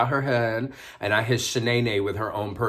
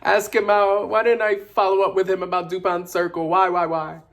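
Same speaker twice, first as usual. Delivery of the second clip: high, loud lament, stretched-out wails; hammed-up regret; 10 semitones higher, 4 dB louder than usual. Lamenting never asking Lamonte out, mock-heartbroken wail. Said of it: "having some feelings about it".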